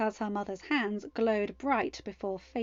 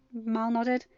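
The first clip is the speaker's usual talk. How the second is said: plain reading voice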